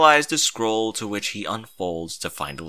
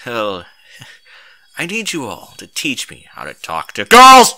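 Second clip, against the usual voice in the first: very loud desperate plea; desperate blurt; 13 dB louder than usual. A narrator's reading. Hero begs the ponies for help, loud desperate blurt. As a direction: blurt it out in a panic.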